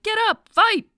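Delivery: high, loud heroic rallying cry; rallying shout. Retold or imitated. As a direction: rouse the crowd with a shout. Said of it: "inspirational heroine speech like".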